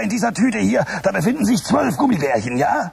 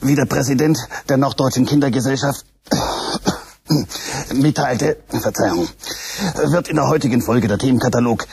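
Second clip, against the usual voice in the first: low, formal broadcast announcer voice; announcer read; 11 semitones lower than usual. Acted. Announcer introducing the show to children, formal news read. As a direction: read it like a stiff news bulletin.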